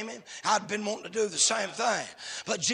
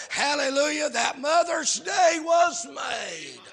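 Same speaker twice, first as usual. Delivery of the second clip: high, drawn-out pulpit proclamation; jubilant preaching; 6 semitones higher, 5 dB louder, slower than usual. Real, not acted. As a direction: stretch it out slowly in triumph, falling at the end. Sermon climax after whoop.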